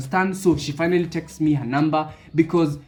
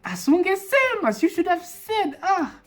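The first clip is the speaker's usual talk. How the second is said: high, pushy customer voice, much higher pitched; demanding customer; 9 semitones higher than usual. Retold or imitated. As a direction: play the impatient customer, voice pitched way up. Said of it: "So she's like".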